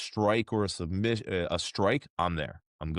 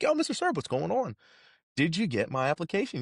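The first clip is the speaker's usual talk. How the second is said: eager greeting; 9 semitones higher than usual. Retold or imitated. Young guy greeting the director.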